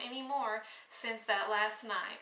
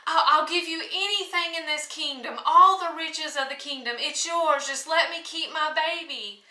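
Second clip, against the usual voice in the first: pleading; 5 semitones higher, 7 dB louder than usual. Acted. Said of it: "she sobbed and sobbed".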